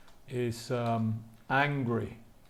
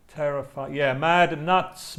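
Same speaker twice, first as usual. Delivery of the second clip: ordinary talk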